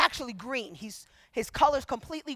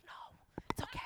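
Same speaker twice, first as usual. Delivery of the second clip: whispered, very soft reassurance; hushed aside; 15 dB quieter than usual. Retold or imitated. Answering the manager leaning over, hushed. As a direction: say it under your breath.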